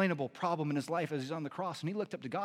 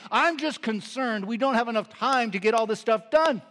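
fretting matriarch; 4 semitones higher, 7 dB louder than usual. Retold or imitated. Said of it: "She says to me".

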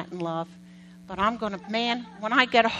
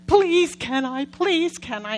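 put-on begging kids; 3 semitones higher, 5 dB louder, slower than usual. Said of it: "our kids would come home crying".

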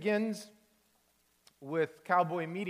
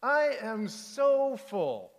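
slow, high, louder polite excuse; fake full; 7 semitones higher, 7 dB louder, slower than usual. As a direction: stretch it out to get out of eating. Octopus politely refuses more food.

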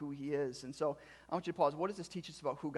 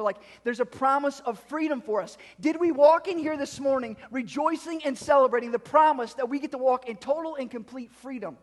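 loud, higher preaching voice; punchy pulpit delivery with leaned-on key words; emphatic preaching; 8 semitones higher, 10 dB louder than usual. Real, not acted. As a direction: drive it home to the congregation. Preaches at full force.

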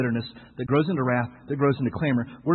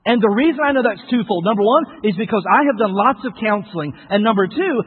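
raised, emphatic preacher voice; forceful preaching; 9 semitones higher, 8 dB louder than usual. Real, not acted. Preacher warning against burying what's alive, forceful and raised. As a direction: drive the warning home, higher and insistent.

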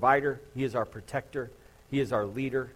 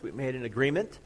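ordinary delivery

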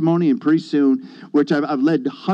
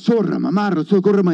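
imitated praying in tongues; 4 semitones higher, faster than usual. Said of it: "this tongue of fire".

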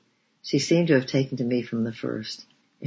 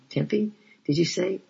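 put-on coaxing a child; 7 semitones higher than usual. A narrator's reading. Missus Miller asking the child her name, coaxing. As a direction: lift the voice high and coax the little one.

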